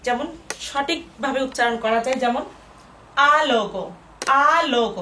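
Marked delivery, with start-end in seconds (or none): 3.4-5.0 first vowel of আলোক drawn out long; টেনে বলা. Shown as a demonstration. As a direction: stretch the আ at the start of আলোক. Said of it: "আমি একটু টেনে বললাম আ". (আলোক-এর আ টেনে বলা)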